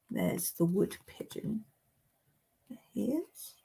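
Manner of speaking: near-whisper, very soft; hushed bird spotting. Pretend. Showing viewers the wood pigeon, in a hushed whisper. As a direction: whisper it so the bird stays put.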